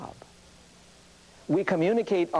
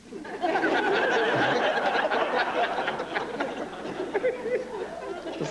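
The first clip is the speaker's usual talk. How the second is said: laughter; 13 semitones higher than usual. Real, not acted. Audience laughs at kid's guess.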